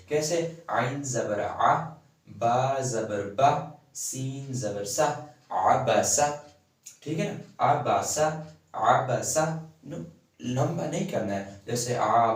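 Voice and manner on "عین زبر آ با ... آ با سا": slow spelling drill with "آ", "با", "سا" each cut very short; short zabar vowels. Shown as a demonstration. Teacher spelling out short vowels for students. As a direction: sound out "عین زبر آ" slowly, the vowel "آ" barely touched. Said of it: "ہم اسے کم سے کم درجے کا چھوٹا پڑھیں گے".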